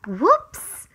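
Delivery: loud surprised whoops. Acted. Reader pretending to kick K. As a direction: yelp it like a sudden slip. Mock accident in story.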